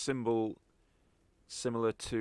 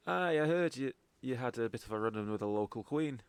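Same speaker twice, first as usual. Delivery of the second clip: put-on Scottish accent; 2 semitones higher than usual. Contact answering the party about their run-in, Scottish accent. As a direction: answer them as a Scottish local. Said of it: "properly in a Scottish accent".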